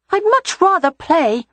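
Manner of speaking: put-on high reluctant child voice; sighing small boy. A narrator's reading. Rye reluctant about lessons.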